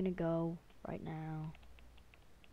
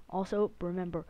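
usual speaking voice

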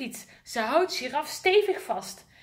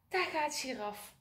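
plain reading voice

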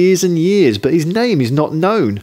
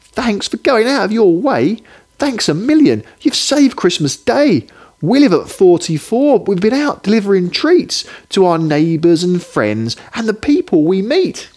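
much higher, smiling grateful mother; smiling mum; 8 semitones higher than usual. Acted. Mum thanking the postman at the door, higher and smiling. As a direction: beam your thanks in a high bright voice. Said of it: "She smiles and says".

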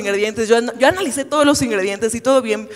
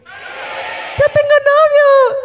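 squealed high and drawn out, much louder; excited announcement; 4 dB louder, much slower than usual. Acted. Announcing to her friends at the pijamada, a high drawn-out squeal. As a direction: shriek the news like a girl at a sleepover. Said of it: "Amigas, ¿adivinen qué?".

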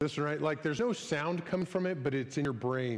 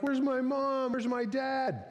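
higher, lightly voiced lost little kid; lost child; 8 semitones higher, slower than usual. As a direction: ask for mom and dad like a scared small child. Voicing a lost crying child.

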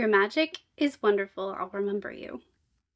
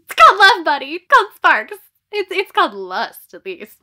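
loud, sassy punch on "called"; teasing retort; 4 semitones higher, 10 dB louder than usual. Real, not acted. Mocking the love interest in the blurb, loud and sassy. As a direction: call it out with a smirk.

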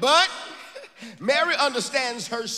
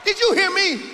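high, loud call to the congregation; shouted call to church; 9 semitones higher, 4 dB louder than usual. Real, not acted. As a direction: demand the church answer back. Preacher calls out.

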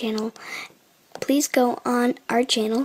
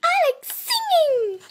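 imitating the kid. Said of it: "The I like trains kid".

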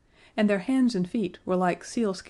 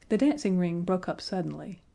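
plain reading voice

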